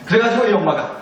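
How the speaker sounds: normal talking voice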